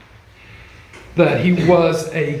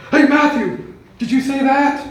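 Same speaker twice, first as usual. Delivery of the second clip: lightly voiced disciple, caught off guard; arguing disciple; 9 semitones higher than usual. Disciple quizzing Matthew in the storm, high mock surprise. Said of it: "was going to go".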